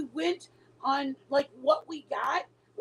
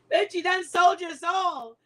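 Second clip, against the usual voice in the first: high, loud and slow through laughter; laughing shout; 6 semitones higher, 5 dB louder than usual. Real, not acted. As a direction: holler it out while cracking up. Hooting a joke through laughter.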